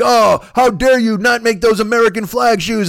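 high, loud, drawn-out indignation; mock outrage. Critics fuming over flag shoes, mock outrage. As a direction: imitate furious complainers, overblown. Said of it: "And they're furious".

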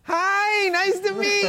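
very high, squeaky mock greeting; Vin Diesel's tipsy voice. Tipsy action star meeting a fan, absurdly high voice. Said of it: "it just came out more like this".

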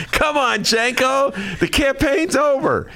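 lampoon a pushy party boss coaxing him; Michael Madigan. Retold or imitated. Host mimics Madigan calling the elected rebel, high mock voice. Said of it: "Mike Madigan reached out to you".

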